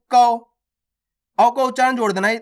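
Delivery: ordinary talk, nothing marked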